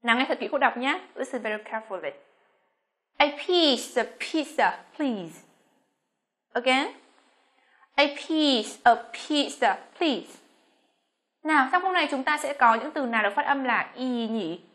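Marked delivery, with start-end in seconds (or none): 3.2-5.4 slow, careful English dictation; slow model reading. Shown as a demonstration. Teacher reading for students to listen closely, slow model phrase. 7.9-10.4 slow, careful English dictation; slow model reading. Shown as a demonstration. Teacher reading for students to listen closely, slow model phrase.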